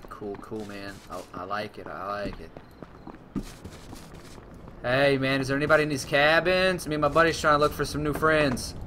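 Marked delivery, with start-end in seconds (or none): 4.8-8.7 loud friendly call. Real, not acted. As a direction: call out to strangers. Calling to strangers in game.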